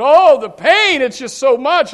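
high, loud, anguished cry voiced for him; dying friend in pain. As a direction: cry out his pain as he told it. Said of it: "He said".

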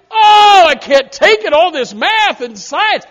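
hammed-up wail of a student, high and loud; mock the kid who can't take school; whiny student. Pastor mocking a tenth grader, high hammed-up wail.

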